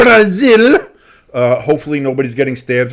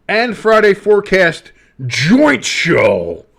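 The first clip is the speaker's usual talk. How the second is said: slow, drawn-out, higher announcer voice; announcer hype; 8 semitones higher, 4 dB louder, much slower than usual. Acted. Announcing next week's joint show to viewers, slow hype voice. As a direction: stretch it like a show promo.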